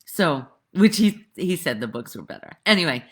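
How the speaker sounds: ordinary delivery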